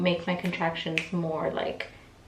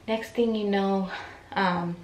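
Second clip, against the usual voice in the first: ordinary talk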